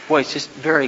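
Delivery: ordinary talk, nothing marked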